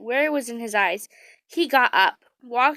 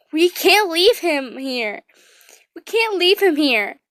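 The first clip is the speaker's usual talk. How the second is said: a touch of a pleading scream, louder; mild mock scream; 6 semitones higher, 6 dB louder than usual. A narrator's reading. Lucy refusing to leave Leo, mild pleading shout. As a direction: plead loudly, not a full scream. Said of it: "you screamed".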